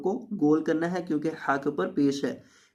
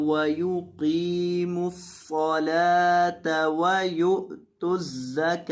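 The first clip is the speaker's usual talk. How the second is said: slow, drawn-out tajweed chanting; Quran recitation; much slower than usual. Shown as a demonstration. Teacher recites the verse for students, slow chanted recitation. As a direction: recite each word slowly, stretching the vowels.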